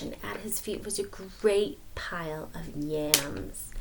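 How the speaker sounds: hushed storyteller whisper. A narrator's reading. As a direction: share a secret with the kids. Whisper narration.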